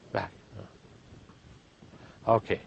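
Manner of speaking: ordinary delivery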